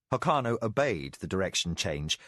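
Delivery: usual speaking voice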